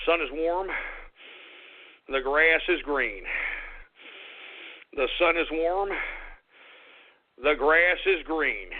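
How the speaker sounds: mock serenity mantra; self-soothing mantra. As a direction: breathe and recite to calm down. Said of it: "let me calm down".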